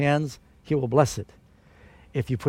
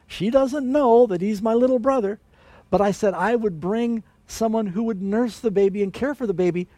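excited little sister; 7 semitones higher than usual. Acted. Sister Miriam tells mother excitedly.

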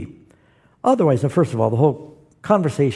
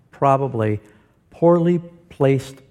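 slow, careful tongue twister; slow down to get through "poorly placed punctuation"; each word spaced out carefully; said slowly; slower than usual. Shown as a demonstration. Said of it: "I had to say that slowly".

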